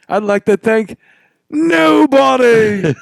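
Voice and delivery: slow, high Irish brogue impression; Irish accent. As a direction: drawl it out like McGregor. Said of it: "that's a whole nother accent".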